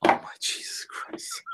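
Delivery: wheezed out through laughter; breathless laughing whisper. Real, not acted. Cracking up at the vowel joke, breathless laughing whisper. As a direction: barely get it out while cracking up.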